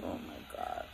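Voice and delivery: breathless dismayed whisper; whispered oh my God. Retold or imitated. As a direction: mutter it under your breath in dread. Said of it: "So I was like".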